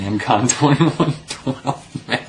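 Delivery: laughs through the commenter's name; laughing. Real, not acted. Thanking the satirist who made him laugh, laughing. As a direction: breaks into a real laugh mid-name.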